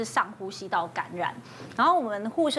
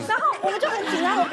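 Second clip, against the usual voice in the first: laughing; 10 semitones higher, 3 dB louder, faster than usual. Real, not acted. Teller laughs recounting panic.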